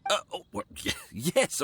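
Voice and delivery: high stammering fluster. Acted. Landlord caught out about rent, flustered stammer. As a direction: stumble over the words, caught out.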